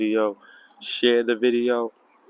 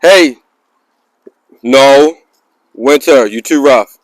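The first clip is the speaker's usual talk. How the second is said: loud sharp scold, pitched up; scolding shout; 6 semitones higher, 15 dB louder, slower than usual. Real, not acted. Owner shouting at the dog for playing too rough.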